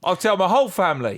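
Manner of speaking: high, twangy mock country stranger. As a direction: keep up the wide-eyed folksy customer. Folksy customer answers.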